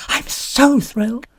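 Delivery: thrilled. A narrator's reading. Thrilled guest.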